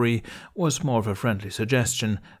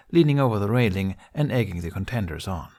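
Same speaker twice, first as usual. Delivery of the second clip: usual speaking voice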